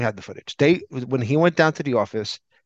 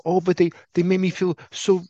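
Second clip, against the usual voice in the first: mock wimpy whine, higher pitched; raised-pitch mock helplessness; wimpy excuse; 7 semitones higher than usual. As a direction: imitate a spineless man making excuses, higher. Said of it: "so wimpy".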